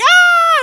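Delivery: high, loud squeal; acted excited scream. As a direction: imitate the parents' big scream.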